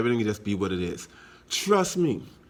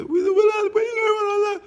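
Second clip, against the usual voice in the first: high squealing question; mock shocked man; 5 dB louder than usual. Man shocked at Willard's grey hair, high squeal. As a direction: screech it like a scandalised man. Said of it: "when I hear him say".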